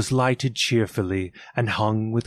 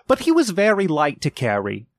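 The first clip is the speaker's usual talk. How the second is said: higher tender mother; voiced mother; 6 semitones higher than usual. A narrator's reading. Mother to children about Tiny Tim, higher tender voice.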